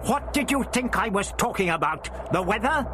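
high, irritated comeback; irritable roar. A narrator's reading. Doctor answering the voice back, irritably. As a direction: retort irritably, pitched high. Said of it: "the doctor roared back irritably".

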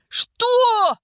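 high, loud, stretched cry of disbelief; outraged roar. A narrator's reading. Vika and Egor hearing of marriage, outraged roar. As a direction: bellow it in shock. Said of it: "Вика и Егор взревели разом".

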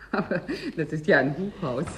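laughing in disbelief; amused disbelieving laughter. Acted. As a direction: laugh the words out, incredulous. Laughing at the offer.

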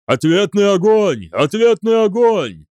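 high, loud battle cry, mounting on the repeat; rally troops under fire; shouted command. A narrator's reading. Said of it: "закричал генерал Деф". (орать команду в бою)

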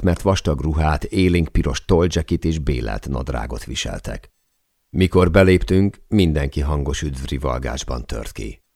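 plain reading voice

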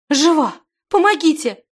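high, loud cry for help; wailing cry. A narrator's reading. Zimana calls for help over the body, wailing. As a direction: call out urgently, high and loud. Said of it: "голосила Зимана".

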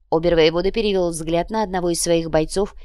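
ordinary delivery, nothing marked

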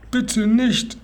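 high, alarmed plea; startled plea. A narrator's reading. Boy begs the robber not to make him eat, startled. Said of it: "sagte Seppel erschrocken".

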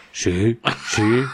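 groggy sepulchral phone answer; retold ghostly "yes" on the phone; voice from beyond the grave. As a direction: answer like someone risen from the tomb. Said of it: "voz de ultratumba".

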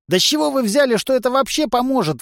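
high, loud, outraged protest; indignant. A narrator's reading. Trishka challenging the whole plan, indignant. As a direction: burst out in indignant disbelief. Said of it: "возмутился Тришка".

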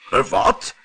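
mildly startled king calling out; a touch of royal alarm; startled cry. A narrator's reading. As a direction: exclaim in brief surprise. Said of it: "riep de koning".

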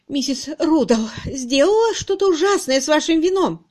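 high, loud, horrified report; distraught. A narrator's reading. Miss Twitterton reporting the wine disaster, distraught. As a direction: blurt out the dreadful news.

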